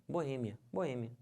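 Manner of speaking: "Boêmia" as bo-Ê-mia; stress on Ê. Shown as a demonstration. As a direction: put the weight on Ê in "Boêmia". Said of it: "O certo é". ("Boêmia" com a tônica no Ê)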